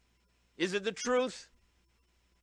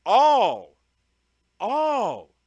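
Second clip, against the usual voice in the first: emphatic all; 11 dB louder than usual. Real, not acted. Pressing Paul's words on the congregation, loud emphatic all. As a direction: shout all out to the hall. Preacher stresses every believer.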